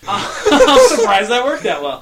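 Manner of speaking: loud high laughing talk. Real, not acted. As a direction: laugh through the words. Laughing after the outburst.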